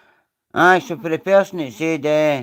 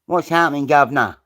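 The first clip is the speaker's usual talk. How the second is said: mock London accent; 3 dB louder than usual. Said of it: "I am from London".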